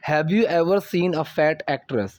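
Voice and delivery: slow model sentence. Shown as a demonstration. Teacher models slow English line.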